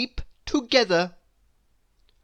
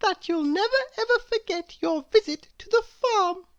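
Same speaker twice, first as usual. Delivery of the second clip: very high mouse voice; mother mouse. Acted. Mother mouse to children after their story, high-voiced. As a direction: keep it high and small throughout. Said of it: "said Missus Mouse".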